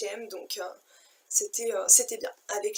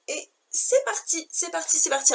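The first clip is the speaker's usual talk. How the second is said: much higher, bright cheer to launch the haul; excited kickoff; 6 semitones higher than usual. Real, not acted. Vlogger launches her clothes haul for viewers, excited high cheer. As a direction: sing out "c'est parti" like a countdown to fun.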